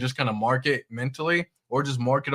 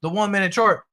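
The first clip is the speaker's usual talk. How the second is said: mock excited trader; 8 semitones higher than usual. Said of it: "that is one hundred percent not the way".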